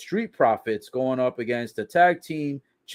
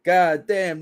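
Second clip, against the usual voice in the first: normal talking voice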